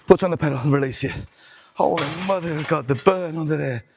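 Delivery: ordinary delivery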